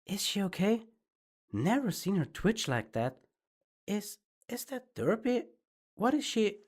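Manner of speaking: high, worried crowd voices; lightly voiced worried onlookers; crowd ponies. A narrator's reading. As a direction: murmur like anxious bystanders. Crowd ponies murmuring in story.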